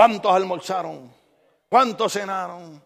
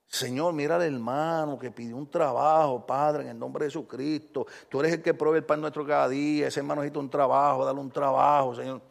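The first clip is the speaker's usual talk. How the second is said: softer, earnest prayer voice; quiet prayer; 6 semitones lower, 7 dB quieter than usual. Retold or imitated. Pastor praying for a jobless brother, soft earnest. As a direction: pray it low and sincere. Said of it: "Cuando yo estoy orando".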